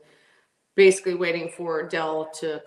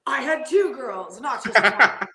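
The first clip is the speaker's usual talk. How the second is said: slow, emphatic retort in a lightly put-on voice; mock indignant correction; 5 semitones higher, 9 dB louder than usual. Racer imitating the legend's reply about his girlfriends, mock indignant. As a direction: set them straight, higher and louder.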